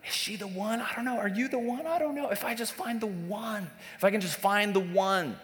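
high, airy mock-longing voice, trading questions with himself; mock dreamy single. Preacher playing a lovesick single hunting for the one, high mock voice. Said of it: "the idea goes".

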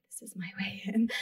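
hushed conspiratorial whisper; barely there, all breath and no voice; whispered inner thought. Retold or imitated. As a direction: whisper it as a secret to yourself. Secret thought during retold chat.

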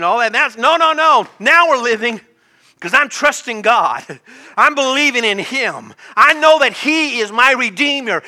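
loud, high, rousing declaration; full-force preaching. Real, not acted. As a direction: proclaim it to the whole congregation.

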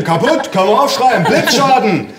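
high, loud verdict; father calling out. A narrator's reading. Father from the kitchen declaring it broken, shouting. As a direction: holler the damage report through the house. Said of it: "rief mein Vater aus der Küche".

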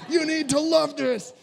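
high, pleading, half laughing; a frazzled father pleading with a laugh; pleading dad. Retold or imitated. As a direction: beg the whining kids to enjoy it. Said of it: "I'm like".

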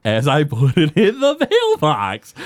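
slow, drawn-out reveal; deliberately slow mock answer. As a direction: spell it out at a teasing crawl. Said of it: "Bart slows it down".

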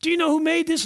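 high-pitched thrill of discovery; excited expert. Acted. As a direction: act out an expert thrilled by the find. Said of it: "And he's so excited".

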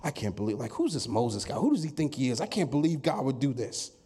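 low, disgruntled griping; mock grumbling. Preacher voices Israelites doubting Moses, mock grumbling. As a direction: grumble low, in disbelief at the leader. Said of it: "now they're grumbling".

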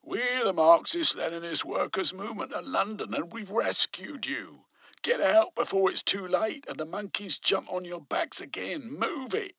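high, loud commanding shout. A narrator's reading. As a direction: order the workers out, impatient. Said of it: "He shouted".

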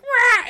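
high, loud crow squawk; imitated crow caw. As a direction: burst out cawing like an angry crow. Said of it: "they're cawing at us".

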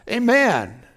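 usual speaking voice

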